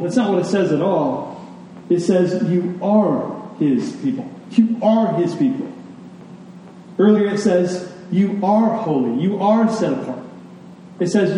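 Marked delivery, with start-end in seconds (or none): 2.5-5.7 "are" stretched and leaned on; emphatic "are", falling away on "his people"; stress on ARE. Real, not acted. Contrasting what the verse says. 8.1-10.2 "are" punched in each phrase; emphatic, raised "are"; stress on ARE. Real, not acted. Insisting on present identity.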